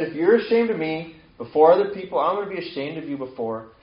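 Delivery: loud, high emphatic quotation, easing off; forceful warning. Retold or imitated. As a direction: proclaim it as a stern warning. Quotes Jesus' warning with force.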